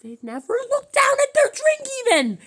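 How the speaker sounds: high, loud, fast outcry; excited shout. Real, not acted. Amazed by diners who never look down, loud and high. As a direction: blurt it out in amazed disbelief.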